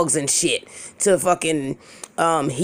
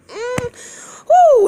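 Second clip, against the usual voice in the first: loud, high-pitched whoop; high "woo"; 5 dB louder than usual. Real, not acted. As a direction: let out a high "woo" to collect yourself. Catching herself after the laugh.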